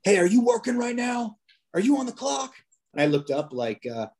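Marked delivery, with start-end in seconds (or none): 0.0-2.5 much higher, hailing shout; imitated yell. UPS driver hollering at an officer across the street, imitated yell. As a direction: call it out like a man yelling from afar. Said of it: "he yells at me from across the street".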